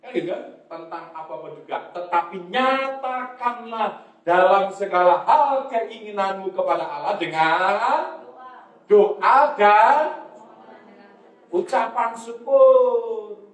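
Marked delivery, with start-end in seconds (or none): 1.9-13.5 stretched, emphatic scripture delivery; ringing pulpit recitation; preaching a verse. Real, not acted. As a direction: draw out each phrase for the congregation. Leads congregation through the verse.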